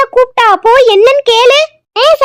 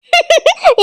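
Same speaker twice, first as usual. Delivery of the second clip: gloating giggle; 4 semitones higher than usual. Acted. Daughter-in-law gloats at news.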